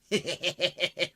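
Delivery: a short amused laugh; burst of laughter. Acted. Laughing at the talk of fattening rats. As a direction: laugh at the silly idea.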